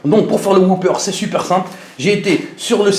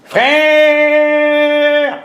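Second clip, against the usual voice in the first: youtuber shout-out; 9 semitones higher than usual. Shown as a demonstration. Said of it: "les youtubeurs qui font des vidéos qui commencent comme ça".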